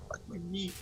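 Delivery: hissed under his breath after banging his knee; a muttered curse clutching a sore knee; pained whisper. Real, not acted. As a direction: whisper it through real pain. Just hit his knee.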